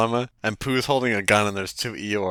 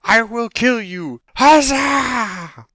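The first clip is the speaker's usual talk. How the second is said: high, loud, stretched battle cry; hammed-up heroic roar with long vowels; grandiose adventurer; 9 dB louder, much slower than usual. Mock hero challenging a dungeon rat, bombastic shout.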